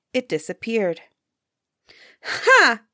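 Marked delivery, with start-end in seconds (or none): 2.2-2.8 high, acted "ha" of derision; scornful laugh. A narrator's reading. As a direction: laugh it out as one mocking word. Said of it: "laughed Helly's wife".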